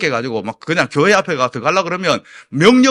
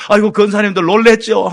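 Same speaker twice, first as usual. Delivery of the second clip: higher, louder fussing concern; flustered apology; 5 semitones higher, 8 dB louder than usual. Retold or imitated. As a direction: hurry to reassure startled church elders. 놀란 권사님들에게 달래듯 말함. (호들갑스럽게 미안해하며)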